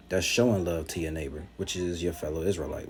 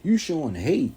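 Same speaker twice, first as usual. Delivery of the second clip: high, whiny mock accuser, pitched up and louder; mocking the critics; 8 semitones higher, 6 dB louder than usual. Preacher mocks rival camps crying you hate us, in a high whiny voice. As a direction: whine it like a sulking accuser. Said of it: "But when we get on them".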